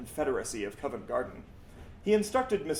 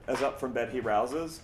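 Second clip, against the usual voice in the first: ordinary delivery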